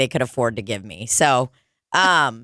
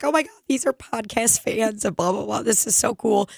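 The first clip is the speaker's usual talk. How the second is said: higher, hushed gushing; soft, pitched-up fan excitement; retold excitement; 4 semitones higher, 4 dB quieter than usual. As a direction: gush to coworkers in a small high voice. Showing it off to coworkers.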